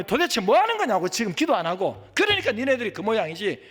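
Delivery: loud, high exasperated telling-off; acted angry rebuke of chatterers; scolding reprimand. Praying man stops to scold friends who chat, scolding reprimand. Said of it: "하고 지적해야 되겠습니까?".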